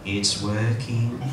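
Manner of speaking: slow, low and dazed; a slow stunned 'it's working'; lightly voiced guy. Guy at a gig, slow and low. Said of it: "looked at me and went".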